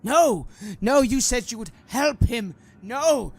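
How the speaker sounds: high, loud anguished cries; desperate pleading. Acted. Priest to the cleric about his son, desperate plea. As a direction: beg and protest at full voice.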